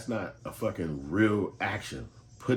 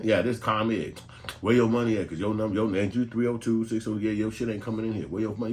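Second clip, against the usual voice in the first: louder, pushy collector barking for money; rude bill collector; 3 semitones higher, 8 dB louder than usual. Retold or imitated. Collector calling customer, loud pushy demand for money. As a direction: lean on him like a street debt collector.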